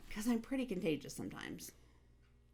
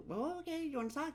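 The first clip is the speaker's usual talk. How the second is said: gentle coaxing; 10 semitones higher, faster than usual. Retold or imitated. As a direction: invite a teary kid to open up. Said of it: "And I'm like".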